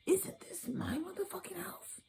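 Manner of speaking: high, slow whispered indignation; mock whisper. Reader voicing Cancer's grievance, slow high whisper. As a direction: hiss it as a secret complaint, slowly. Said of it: "The whole time you're like".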